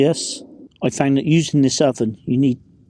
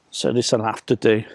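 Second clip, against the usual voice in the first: normal talking voice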